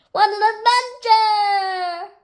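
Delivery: high, loud, singsong delight; thrilled exclamation. A narrator's reading. Rabbit celebrating with friends, high singsong excitement. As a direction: sing it out, thrilled. Rabbit exults after adventure.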